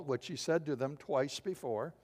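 ordinary delivery

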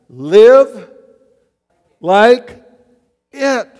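loud punched words, one at a time; shouted slogan. Real, not acted. Preacher booming to his flock, word by word. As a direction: hammer each word to the congregation.

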